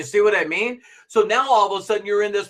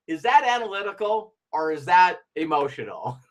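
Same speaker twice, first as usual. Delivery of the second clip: ordinary talk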